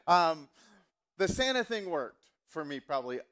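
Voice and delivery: ordinary talk, nothing marked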